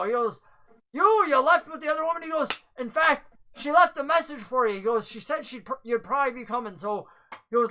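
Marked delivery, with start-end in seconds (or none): 0.9-6.8 flustered messenger. Acted. Flustered man relaying a message.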